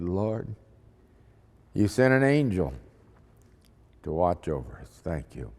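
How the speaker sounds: grateful prayer. Retold or imitated. Grateful prayer after escaping danger.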